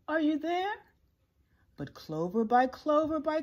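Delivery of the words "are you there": high, searching call; raised questioning call. A narrator's reading. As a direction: call out hopefully, pitch rising at the end. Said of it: "and called".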